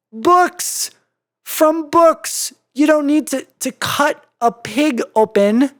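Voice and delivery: high, loud indignant cry; indignant outburst. Acted. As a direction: protest in outrage. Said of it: "Twilight scowled".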